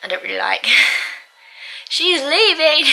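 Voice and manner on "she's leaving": laughing, higher and louder, slow; giggling delight that she's gone. Real, not acted. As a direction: burst out gleeful at the news. Laughing gleeful news.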